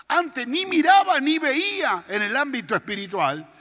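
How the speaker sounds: high, loud pulpit climax; fiery preaching. Acted. Preacher proclaiming to the congregation in full fiery voice.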